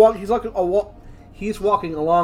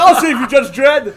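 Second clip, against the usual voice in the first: mock heroic shout; 6 semitones higher, 7 dB louder than usual. Mocking heroic judge.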